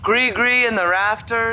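hammed-up poetry reading; bad poet voice. Restarting the line after a slip, bad poet voice. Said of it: "read it in a bad poet voice".

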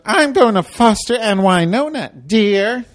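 high, loud, dismissive driver; bus driver voice. Retold or imitated. Driver brushing off the rider, high and loud. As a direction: talk down to her, high and sweet. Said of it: "she goes".

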